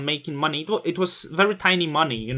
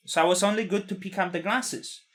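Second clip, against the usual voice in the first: ordinary delivery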